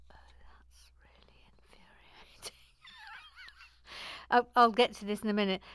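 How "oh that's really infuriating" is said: barely audible, unvoiced grumble; whispered aside. Real, not acted. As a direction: whisper your annoyance to yourself. Notices something annoying, aside.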